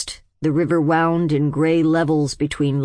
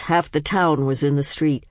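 ordinary delivery

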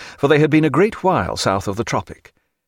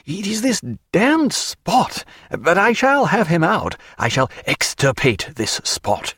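high fussy doctor voice; 8 semitones higher than usual. A narrator's reading. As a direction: declare war on the spot. Doctor fussing over the spot.

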